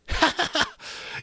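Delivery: breathy, eerie high laugh; a laugh that hushes everyone; strange laugh. A narrator's reading. Piggy to the boys, a strange sour laugh. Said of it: "he laughed so strangely".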